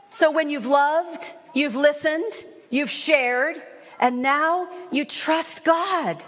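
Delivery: ordinary talk, nothing marked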